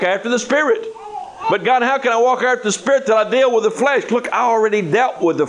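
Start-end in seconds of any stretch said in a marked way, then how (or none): 1.5-4.1 high, whiny plea to God; raised, complaining "But God"; pleading believer. Acted. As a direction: beg God like a frustrated believer.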